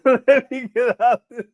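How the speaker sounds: slow words mixed with real laughter; laughing. Real, not acted. Streamer laughing at the chat, trying to leave it. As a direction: laugh it out, barely getting the words through.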